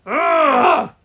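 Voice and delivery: high, very loud "ugh"; frustrated groan. A narrator's reading. Henry frustrated late in the match, loud groan. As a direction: let out an exasperated roar.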